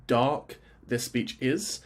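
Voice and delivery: normal talking voice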